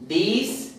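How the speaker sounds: "These" with a long ee, as in cheese; long vowel demo. Shown as a demonstration. Teacher contrasting this and these, long-vowel demo on "These". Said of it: "these es como cheese".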